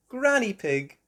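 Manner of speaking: ordinary delivery, nothing marked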